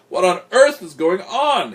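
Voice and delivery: loud, high, incredulous demand; exasperated astonishment. A narrator's reading. Henry bursts in on uproar.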